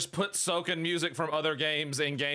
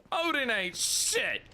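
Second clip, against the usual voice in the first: mocking taunt; 7 semitones higher, slower than usual. Real, not acted. Ranting at boss Odin.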